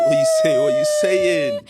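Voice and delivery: very high, loud party call; hype shout. Real, not acted. Co-host answers the intro with a loud, high hype call. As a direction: yell it like a crowd hype man.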